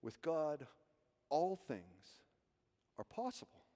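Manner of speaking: soft inside voice, "all" and "possible" set apart by pauses; stress on ALL and POSSIBLE. Shown as a demonstration. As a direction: quietly lean on "all" and "possible". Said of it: "on the words all and possible".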